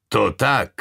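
gruff boss's retort; rough voice. A narrator's reading. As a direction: answer as a rough-voiced superior. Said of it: "odpověděl hrubý hlas".

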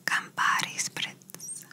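slow close whisper right into the ear. Shown as a demonstration. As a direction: lean in and breathe it into the ear, slowly. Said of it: "I'm going to whisper something in your ear".

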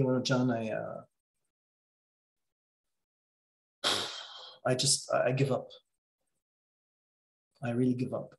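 low, soft, giving-up voice; defeated client. Retold or imitated. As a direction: sound worn out and beaten, trailing quietly. Client in despair, voiced by coach.

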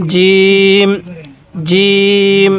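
firm "j", then a long level "eem" in জিম; hard জিম. Shown as a demonstration. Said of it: "জিমটা শক্তভাবে উচ্চারণ করব".

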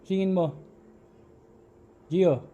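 high loud urgent call. Real, not acted. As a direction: shout the order into the house. Calling son again.

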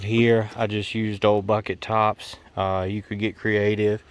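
usual speaking voice

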